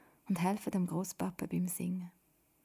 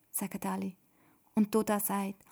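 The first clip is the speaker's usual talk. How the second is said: usual speaking voice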